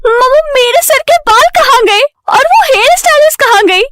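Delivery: very high, alarmed cry for mother; shocked wail. A narrator's reading. Payal sees her bald head in the mirror, shocked wail.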